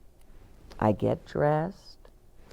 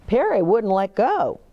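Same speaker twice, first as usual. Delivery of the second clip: ordinary delivery, nothing marked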